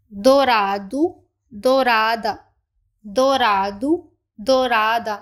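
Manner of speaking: dourado in a low, even, careful voice; model pronunciation. Shown as a demonstration. Teacher models dourado and dourada, low and clear. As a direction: clear doh-RAH-doo for learners. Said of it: "पहले हम इनकी pronunciation को देख लेते हैं".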